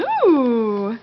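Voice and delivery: lightly mooed echo; a mild cow moo for the toddler; cow sound. Shown as a demonstration. Mother echoing her toddler's moo, lightly voiced. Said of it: "What does the cow say?".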